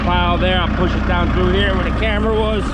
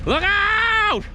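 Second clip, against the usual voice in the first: very high, TV-logger warning holler; Axemen-style yell. Retold or imitated. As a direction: holler it like a reality-show logger. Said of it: "Just like Axemen".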